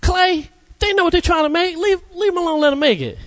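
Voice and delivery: high, incredulous bystander; high, shocked bystander scolding the clay; astonished onlooker. Retold or imitated. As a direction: gasp at the clay in disbelief. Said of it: "be like".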